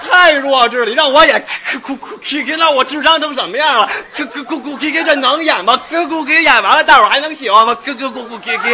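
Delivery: high, hammed-up exasperation with silly noises; indignant protest. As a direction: complain loudly to the director. (高声抗议，夸张地学着滑稽怪声)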